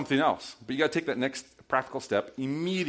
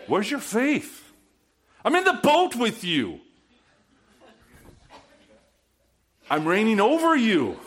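Jesus rebuking; 8 semitones higher than usual. Retold or imitated. Jesus to frightened disciples in the boat, a firm rebuke. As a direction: challenge them, where's your faith. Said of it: "no, he said".

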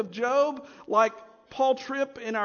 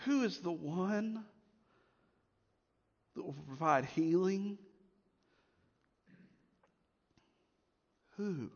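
hushed question; 5 semitones lower, 8 dB quieter than usual. Real, not acted. Pastor quietly asking his church who heals. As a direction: let it drop to a hush.

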